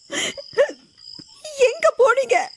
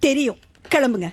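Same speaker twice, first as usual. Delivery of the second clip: curt dismissal; 6 semitones lower than usual. Acted. Meera sends neighbour away.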